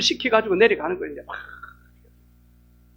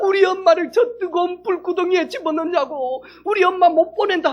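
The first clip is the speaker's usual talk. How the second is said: wailing protest; 10 semitones higher, 5 dB louder than usual. Retold or imitated. Sisters resisting cremation.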